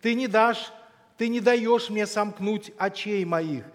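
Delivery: ordinary talk, nothing marked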